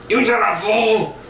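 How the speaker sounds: high, hammed-up comeback; mock yelled retort. Buddy yells back in acted quarrel.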